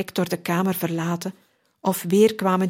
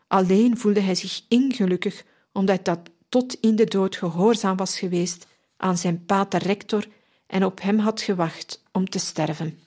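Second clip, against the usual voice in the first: usual speaking voice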